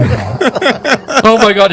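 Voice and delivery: horrified, welling up. Retold or imitated. Lady exec reacting to the dog story, mock horror. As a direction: imitate a shocked woman, slow and high. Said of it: "she started welling up".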